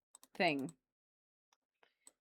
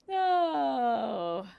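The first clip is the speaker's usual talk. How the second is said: ordinary talk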